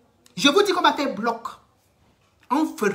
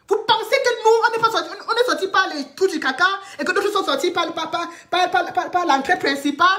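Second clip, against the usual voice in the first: much higher, fast, mocking tone with a laugh; scornful mockery; 8 semitones higher, faster than usual. Real, not acted. A woman mocks critics who think they're superior, high scornful voice. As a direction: ridicule the idea as absurd.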